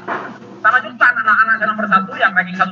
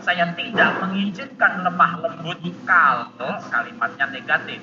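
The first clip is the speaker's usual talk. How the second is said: normal talking voice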